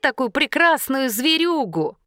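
put-on high-pitched girl character; high girlish wonder; admiring girl customer.

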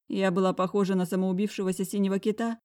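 usual speaking voice

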